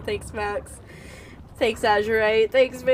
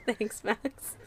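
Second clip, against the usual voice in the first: ordinary talk, nothing marked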